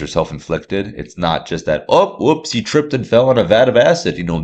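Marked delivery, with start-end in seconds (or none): 1.9-4.2 higher, goofy mock-dismissive voice; mocking cartoon mishap, high-pitched; mock clumsy accident. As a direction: make a silly oopsie of it, pitched up. Mocking a simplistic origin story.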